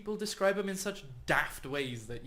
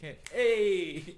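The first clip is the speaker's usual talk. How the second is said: pompous greeting; 4 semitones higher, 6 dB louder than usual. Acted.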